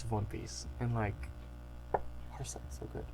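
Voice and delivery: hushed, breathy aside of delight; whisper. Real, not acted. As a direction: whisper it like a secret treasure. Gushing aside about the best arc.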